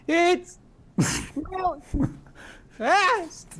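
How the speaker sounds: very high, stretched-out words with gaps; high, broken-up flyby holler; shouting while flying by. Acted. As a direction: call it in high snatches as you whoosh past. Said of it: "just, like, flying by".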